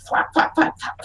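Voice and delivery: unvoiced, fading flap sounds; imitated flapping. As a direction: make quick breathy flaps that die away. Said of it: "you hear this, like".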